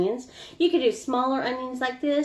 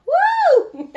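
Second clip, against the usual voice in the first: high, loud laughing whoop; excited whoop; 8 dB louder than usual. Real, not acted. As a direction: let out a giddy woo through laughter. Startled by the onion tears.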